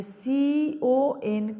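CON stretched very slowly letter by letter; teacher stressing a tricky spelling; drawn-out spelling. Shown as a demonstration. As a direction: hold each letter of CON long. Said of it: "স্পেলিংটা কিন্তু খেয়াল রাখবে".

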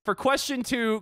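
normal talking voice

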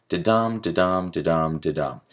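"da-dum" four times in a connected run, stress on each dum; steady "da-dum" pattern, weight on every dum; iambic flow. Shown as a demonstration. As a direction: roll through "da-dum" as a regular beat. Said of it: "that line sort of breaks up the".

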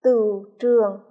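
Tửu trường said loud and carefully, each syllable clear; model pronunciation. Shown as a demonstration. Teacher models Tửu trường for pupils, loud and clear. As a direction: spell out Tửu trường for the class, loudly. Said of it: "chú ý vần ưu trong tiếng tửu".

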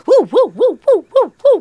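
high, loud happy whoops; gleeful cheering whoops; excited woo. Retold or imitated. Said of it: "I was in class just happy".

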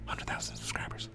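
put-on hushed whisper to sleeping kids. Dad waking his sleeping kids, whispering. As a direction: breathe it out so nobody fully wakes. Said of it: "wake her up".

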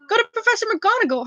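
fast, high urgent plea; urging. A narrator's reading. Hermione urges Neville to report Malfoy, fast and high. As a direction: hurry him off to a teacher. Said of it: "Hermione urged Neville".

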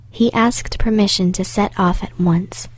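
ordinary delivery, nothing marked

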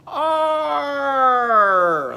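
long, loud, high "arr" sliding down; demonstrated "arr" sound. Shown as a demonstration. Teacher has children copy Arnie's sound, long loud arr. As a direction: draw out a big falling "arr". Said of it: "say this sound after me".

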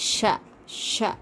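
ஷ as sha with tongue tip curled up to the palate; slow, retroflex sha on each ஷ. Shown as a demonstration. Teacher demonstrates the tongue position, retroflex ஷ. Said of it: "நாவின் நுனிப்பகுதி மேல் நோக்கி வளைந்தவாறு".

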